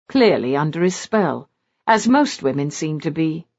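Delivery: plain reading voice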